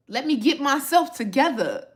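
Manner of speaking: ordinary talk, nothing marked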